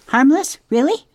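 can't believe its ears; incredulous opossum. Acted. Opossum squeaking in loud disbelief at the robot.

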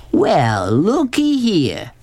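slow, swooping, drawn-out character voice; swaggering Southern man. A narrator's reading. Beauchamp on seeing Sistine, slow swaggering drawl. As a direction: draw it out with leering swagger.

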